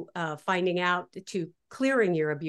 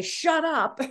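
mock yell; 8 semitones higher, 3 dB louder than usual. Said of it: "if you just scream at it and say".

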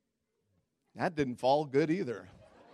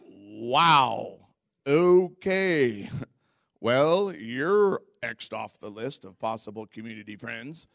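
offended sarcasm; 3 semitones lower, 6 dB louder, much slower than usual. Retold or imitated. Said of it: "And I was like".

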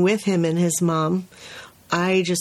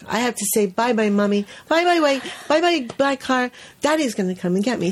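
put-on higher, eager little-boy voice giving a play-by-play; child narrating like a sportscaster; 3 semitones higher than usual. Teacher imitating a toddler narrating his goodbye. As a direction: chirp it out like a three-year-old calling his own moves. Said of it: "he was like a sportscaster".

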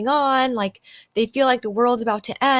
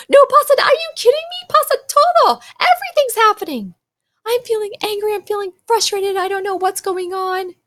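much higher and louder, indignant outburst; upset protest; 11 semitones higher, 5 dB louder than usual. Retold or imitated. Voicing the upset child who talks back to the parent, shouting high and indignant. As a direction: blurt it out like a frustrated kid who is fed up.

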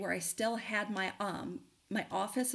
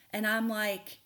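usual speaking voice

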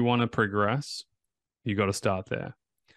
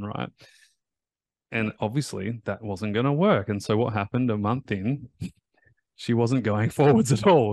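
ordinary talk

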